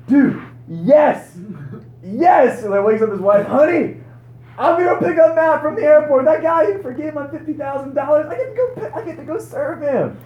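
very high, loud, giddy shouts; overjoyed he gets to help; ecstatic yelling. Retold or imitated. Mike waking his wife, shouting with joy. Mike thrilled to serve, voiced.

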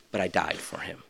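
hushed voice of Jesus; whisper. Retold or imitated. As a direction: breathe it tenderly as Jesus. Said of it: "The gospel needs to preach to your story".

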